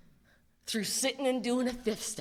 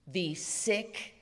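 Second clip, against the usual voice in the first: whisper; 5 semitones lower, 6 dB quieter, slower than usual. Real, not acted. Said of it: "whispered aside".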